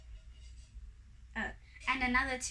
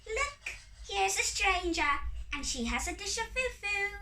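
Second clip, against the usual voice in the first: high, light fairy voice; excited high-pitched fairy; tinkling fairy cry; 9 semitones higher than usual. A narrator's reading. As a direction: call out in a little high tinkly voice. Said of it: "one of them cried in a tinkling voice".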